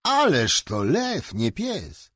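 a high, slow, amazed exclamation; a boy thrilled by the giant dog, amazed; enthusiastic. Acted. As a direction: marvel at the size out loud. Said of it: "wpadł w zapał".

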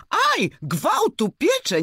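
high, slow cry of pain; ow it burns. Acted. Monkey scalds its finger in the bath, high pained yelp.

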